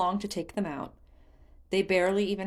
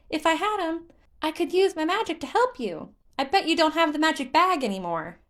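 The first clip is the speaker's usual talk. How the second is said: high, loud, sulky spirit voice; a child sulking about a lost toy; pouting Korok; 10 semitones higher, 7 dB louder than usual. Acted. Hestu explains, pouting.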